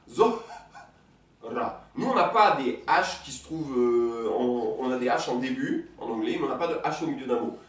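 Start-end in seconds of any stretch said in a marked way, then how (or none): 0.1-1.8 Zoh- Ra, split into two slow syllables; Zoh- then Ra, separated. Shown as a demonstration. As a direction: break Zohra apart. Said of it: "regardez le Zohra".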